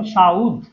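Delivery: "সাউদ" stressed, U before the final D; U brought forward. Shown as a demonstration. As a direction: punch out "সাউদ" as sha-ud. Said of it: "এই উটা কিন্তু আগে চলে আসে". ("সাউদ" জোর দিয়ে, উ আগে এনে)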